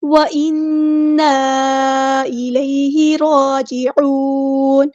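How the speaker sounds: slow, drawn-out melodic tajweed recitation; Quran recitation. Shown as a demonstration. Teacher recites the verse for students, slow melodic chant. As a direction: chant each word slowly in tajweed.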